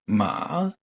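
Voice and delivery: MA dipping then climbing; third tone. Shown as a demonstration. Teacher shows learners the third Chinese tone, falling then rising. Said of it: "celui qui descend pour remonter et arriver plus haut".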